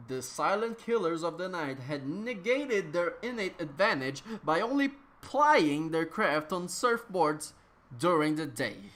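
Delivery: ordinary delivery, nothing marked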